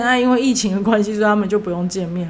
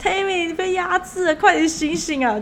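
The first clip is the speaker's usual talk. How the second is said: mock shout; 9 semitones higher than usual. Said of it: "就大喊".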